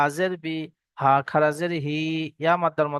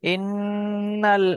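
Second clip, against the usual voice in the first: Quran recitation; 4 semitones higher, much slower than usual. Shown as a demonstration. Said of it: "teacher recites the verse".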